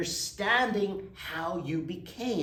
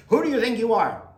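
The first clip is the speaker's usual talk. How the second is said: loud, high, frustrated yelling again in the argument; frustrated shout; 7 semitones higher, 10 dB louder, faster than usual. Retold or imitated. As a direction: shout it back at the calm man. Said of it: "you say".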